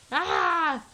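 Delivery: short, high, loud mock scream; freaked-out scream. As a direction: let out a sudden playful "Ah!" of panic. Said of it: "Freaking out".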